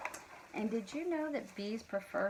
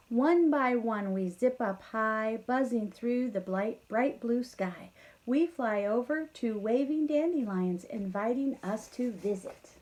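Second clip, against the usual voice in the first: lively storybook read-aloud; reading to children; 6 dB louder than usual. A narrator's reading. Teacher reading the bees' tale to kids, lively. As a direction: read the bees' lines brightly to the class.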